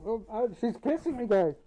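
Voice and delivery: high, loud excited reaction; excited squeal. Real, not acted. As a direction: squeal it out, giddy and flustered. Reacting to playful kissing redeem.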